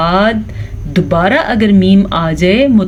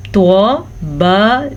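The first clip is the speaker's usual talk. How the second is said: normal talking voice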